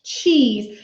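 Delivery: normal talking voice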